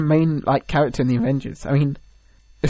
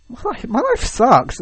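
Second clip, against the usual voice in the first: mock self-pity; 8 semitones higher, slower than usual. Hank Pym sulking alone.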